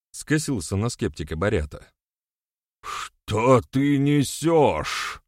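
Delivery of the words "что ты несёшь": high, drawn-out indignant outburst; flaring up. A narrator's reading. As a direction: stretch it out in high outrage. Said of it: "взвился тот и отступил". (взвившись, протяжно и возмущённо)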